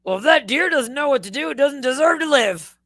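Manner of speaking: hammed-up gruff dad verdict; Archie Bunker. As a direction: bellow it like a callous old curmudgeon. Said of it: "in his Archie Bunker way".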